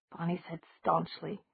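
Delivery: ordinary delivery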